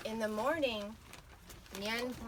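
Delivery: plain reading voice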